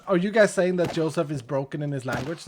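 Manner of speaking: ordinary delivery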